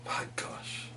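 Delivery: breathless whisper of shock; whispered gasp. Acted. Heron spotting the otter, whispered shock. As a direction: hush it like a secret surprise.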